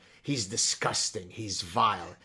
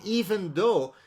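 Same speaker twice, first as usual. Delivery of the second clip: loud, scornful rant as a snobbish liberal; hammed-up loud sneer from a self-satisfied elitist; mock smug progressive; 2 semitones higher, 11 dB louder than usual. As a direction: play the smug Malibu progressive sneering at the pig. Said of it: "I'm speaking now as the super smart progressive".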